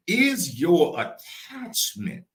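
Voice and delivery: high, very slow, stretched words; slow preaching. Real, not acted. Preacher asking is your attachment greater, slow preaching. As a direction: draw out the question for weight. Repeats key question, very slow.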